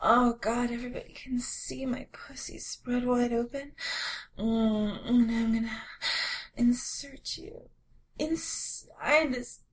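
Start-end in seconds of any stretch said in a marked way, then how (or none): 0.7-3.7 whispering. Acted. Whispered to tiny lover. 4.4-9.6 whispering. Acted. Slow whispered seduction.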